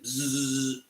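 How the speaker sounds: soft buzzing whisk noise; imitated whisk buzz. Host showing viewers how he whisks his coffee, soft buzz. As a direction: buzz like a little electric whisk.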